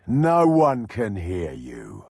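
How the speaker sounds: ordinary talk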